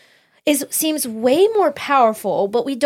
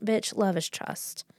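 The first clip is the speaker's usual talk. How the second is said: inner retort; 2 semitones lower, 9 dB quieter than usual. Retold or imitated. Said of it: "in my head went".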